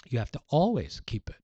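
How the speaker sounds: usual speaking voice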